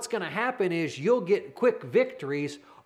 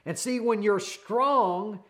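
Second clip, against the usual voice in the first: ordinary talk, nothing marked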